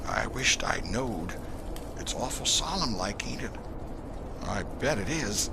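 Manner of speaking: put-on hushed backwoods boy, scared; whispering Huck. A narrator's reading. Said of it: "Huckleberry whispered".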